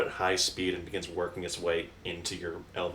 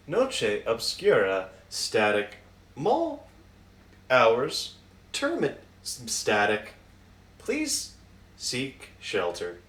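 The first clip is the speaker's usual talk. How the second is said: crackly automated announcement; 3 semitones higher, slower than usual. Retold or imitated. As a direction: read it like a glitching emergency broadcast. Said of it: "an automated voice going, like, static".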